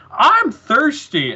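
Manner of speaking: high, loud, slow whiny puppet. Retold or imitated. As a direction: moan it like a thirsty puppet animal. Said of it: "they're like".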